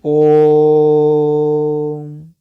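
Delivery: drawn-out pluta vowel on ओम; prolonged Om. Shown as a demonstration. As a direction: hold ओम long and steady like a chant. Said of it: "ओम कहने में कितना समय लगेगा".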